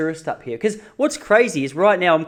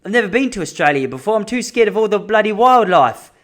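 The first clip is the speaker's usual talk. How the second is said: higher, louder put-on British voice; a Londoner, loud and higher, in a mock British accent; 6 semitones higher, 5 dB louder than usual. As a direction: imitate a British friend in a hammed-up British accent. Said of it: "'cause they're British".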